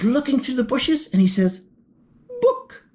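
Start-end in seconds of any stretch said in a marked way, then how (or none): 2.4-2.8 shocked. A narrator's reading. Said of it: "I think he's shocked".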